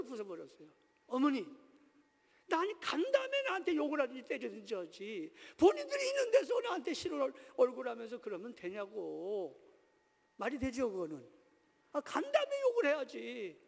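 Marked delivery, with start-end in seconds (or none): 1.1-9.6 high, loud reenacted outburst at mother; imitating a son's furious complaint; angry protest. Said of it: "얼마나 화가 나는지". 11.9-13.6 high-pitched reenacted complaint; imitating an indignant son; angry protest. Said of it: "얼마나 화가 나는지".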